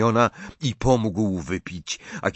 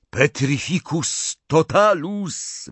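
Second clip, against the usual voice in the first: yelled spell; 6 semitones higher, slower than usual. A narrator's reading. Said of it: "wrzasnął Harry".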